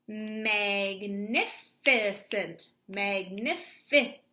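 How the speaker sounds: Magnificent drawn out very slowly; very slow stretch. Shown as a demonstration. Teacher stretches the whole word for students, very slow. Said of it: "I know those letters".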